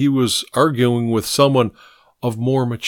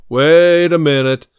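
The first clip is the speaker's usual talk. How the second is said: loud, raised-pitch command; sharp order; 4 semitones higher, 6 dB louder than usual. A narrator's reading. Steinweister stopping the men dragging Pink, sharp command. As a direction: halt them with a loud, sharp call. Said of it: "Randolph Steinweister ordered sharply".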